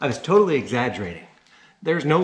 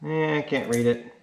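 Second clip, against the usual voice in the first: normal talking voice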